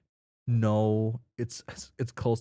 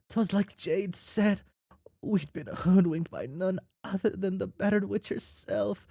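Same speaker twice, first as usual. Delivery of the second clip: lilting, higher storyteller read; storytelling voice; 11 semitones higher than usual. Acted. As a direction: spin the tale like a fireside yarn. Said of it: "get back into my storytelling voice".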